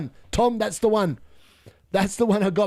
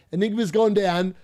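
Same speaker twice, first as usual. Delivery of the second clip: esports caster; 2 semitones higher than usual. Retold or imitated. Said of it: "they're casting the game".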